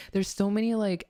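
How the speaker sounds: ordinary talk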